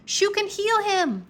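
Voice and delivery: high urgent call; yelled. A narrator's reading. Drake still calling out to Bo, a high urgent call. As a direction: keep shouting the plan to Bo. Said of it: "Drake yelled".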